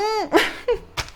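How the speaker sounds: high-pitched giggle. Real, not acted. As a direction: giggle at yourself. Laugh after gushing.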